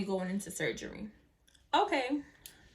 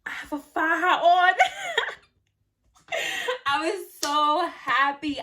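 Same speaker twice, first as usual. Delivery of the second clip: higher, louder, slow laughing delight; laughing with joy; 6 semitones higher, 6 dB louder than usual. Retold or imitated. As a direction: laugh out the happy news. Joy on seeing faja.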